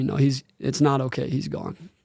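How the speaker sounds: holding back tears as the words sink away; retelling the dad's news while choking up; emotional, voice fading. Real, not acted. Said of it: "Why is it hitting right now?".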